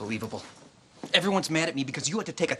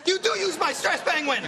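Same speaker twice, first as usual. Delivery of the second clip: indignant; 13 semitones higher than usual. Real, not acted. Catching brother out.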